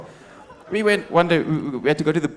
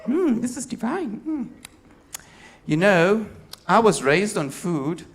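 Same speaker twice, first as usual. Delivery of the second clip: much higher, savouring mock-posh voice; high, indulgent hmm then slow; pretentious foodie; 5 semitones higher, slower than usual. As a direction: purr over the food like a snob. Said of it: "She goes".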